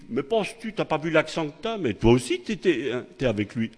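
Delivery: lightly voiced servant girl accusing Peter. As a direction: press him, a touch shrill in tone. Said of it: "Oh, ben, tu dis".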